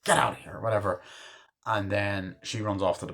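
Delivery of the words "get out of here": high, sharp mock scolding; emphatic, pitched-up telling-off; Henry Winkler as the principal. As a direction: act an angry principal ordering pupils out. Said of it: "Henry Winkler as the principal's like".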